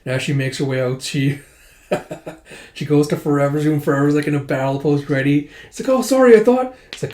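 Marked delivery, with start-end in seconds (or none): 5.9-6.8 much higher, louder startled yelp; startled apology. Retold or imitated. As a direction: jump back flustered. Startled by a girl in battle pose.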